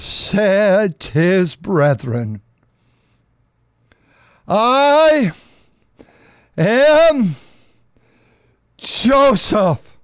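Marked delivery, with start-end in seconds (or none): 4.5-10.0 loud, high, drawn-out reveal; dramatic reveal. A narrator's reading. Preacher as Joseph revealing himself to his brothers, loud and high. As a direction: announce it like a long-hidden secret.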